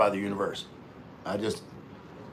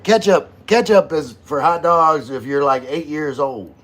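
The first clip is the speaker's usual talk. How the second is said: loud rant; 5 semitones higher, 9 dB louder than usual. Real, not acted. Ranting about ketchup on hot dogs.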